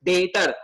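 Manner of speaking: "डेटर" with the B left silent. Shown as a demonstration. Teacher repeats the word for students, "डेटर" without any B. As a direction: say "डेटर" with only a T in the middle. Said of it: "बी silent है".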